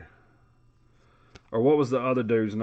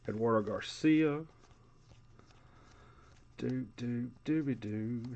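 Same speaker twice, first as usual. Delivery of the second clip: ordinary delivery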